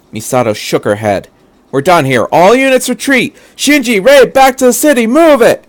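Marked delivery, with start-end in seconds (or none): 1.7-5.6 very high, loud commanding shout; urgent retreat order. Acted. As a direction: yell everyone back to the city. Misato orders full retreat.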